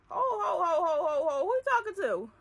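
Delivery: loud, high indignant outburst with a cry in it; high, loud sassy comeback. Retold or imitated. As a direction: rear up at him, who do you think you're talking to. Snapping back at the boss.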